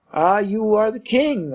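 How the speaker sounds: crying out. A narrator's reading. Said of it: "I cried".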